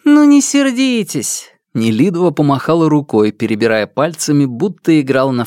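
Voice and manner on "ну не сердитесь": high, slow coaxing voice; teasing plea not to be angry; coaxing lady. Acted. Nelidova soothing teasingly. (ласково-дразнящий тон)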